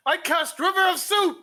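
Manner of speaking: high, loud spell declaration; grand spell shout. Pretend. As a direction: boom it like a wizard. Dramatic spellcasting.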